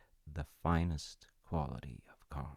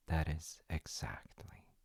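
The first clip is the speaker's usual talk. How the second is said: hushed; 8 dB quieter than usual. A narrator's reading. Storyteller's hushed closing line.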